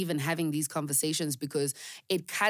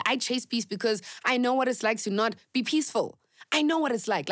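higher, building insistence; impassioned; 6 semitones higher than usual. Real, not acted. Telling listeners she knows unpeace, rising passion. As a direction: press it home with conviction. Passionate rant about past.

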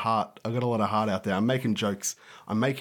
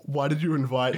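normal talking voice

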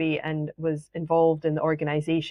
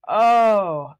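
loud, higher drawn-out "oh"; sudden realization; 4 semitones higher, 9 dB louder than usual. Retold or imitated. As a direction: act out the penny dropping. Retelling the girl's sudden realization.

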